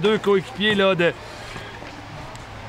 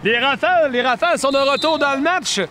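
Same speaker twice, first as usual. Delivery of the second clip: excited sports commentary; 6 semitones higher than usual. Real, not acted. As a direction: shout the comeback goal to the crowd. Comeback goal called live.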